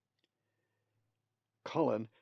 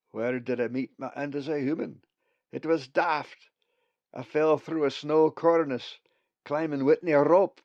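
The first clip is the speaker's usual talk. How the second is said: higher, louder Scots voice, rueful; a Scots raven calling his death daft; Scottish brogue; 5 semitones higher, 10 dB louder than usual. A narrator's reading. Bugs the raven speaks.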